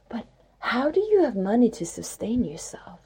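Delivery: unvoiced whisper, asking nosily; whispered question. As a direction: breathe the question like a secret. People asking about her money.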